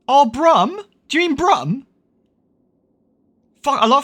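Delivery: high, delighted recognition; thrilled a viewer mentions Brum; excited. Real, not acted.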